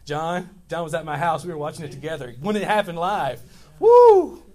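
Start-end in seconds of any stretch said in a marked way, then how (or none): 3.8-4.4 high, loud whoop of disbelief. Real, not acted. Pastor to congregation recalling the bad call, loud whoop. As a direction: let out a big woo like a shocked fan.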